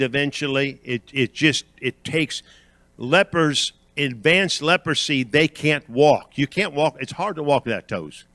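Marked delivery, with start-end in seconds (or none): none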